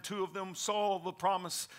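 usual speaking voice

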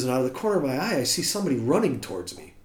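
ordinary delivery

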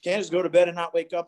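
ordinary delivery

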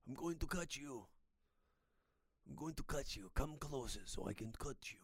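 understated mock knife threat; lightly menacing thug. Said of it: "how the Taliban's talk".

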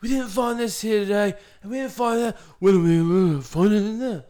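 hammed-up, high-pitched presenter impression; Tony Robinson. Imitating a TV presenter on a dig, high and hammed-up. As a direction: wail it with overblown drama. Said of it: "Tony Robinson hams it up so much".

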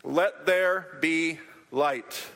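slow, solemn divine command; slow proclamation. Acted. God speaking light into being, slow and solemn. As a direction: let each word land like a decree. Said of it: "And God said".

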